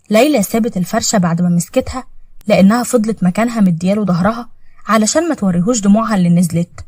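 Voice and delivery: usual speaking voice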